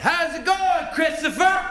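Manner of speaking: put-on half-drunk father hailing his son; drunk dad greeting.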